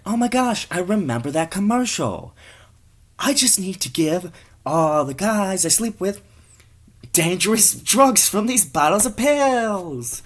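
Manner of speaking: high mock-female voice; gushing woman. Woman recalling the commercial, high and gushing. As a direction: pitch it high, as if struck by an idea.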